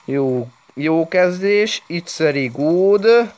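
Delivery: slow, high drawn-out praise; mock announcer voice. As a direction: stretch it like a cheesy host. Slow, far voice praising start. (lassú, elnyújtott műsorvezetői hang)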